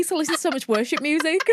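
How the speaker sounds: ordinary talk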